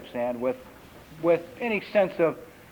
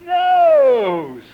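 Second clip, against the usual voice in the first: put-on loud, high, drawn-out toddler call; little toddler; 6 semitones higher, 9 dB louder than usual. Farquhar in the kitchen showing parents, loud high call. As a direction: proudly announce a new word, toddler-style. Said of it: "he goes".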